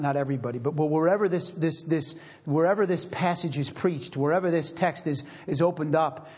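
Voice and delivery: ordinary talk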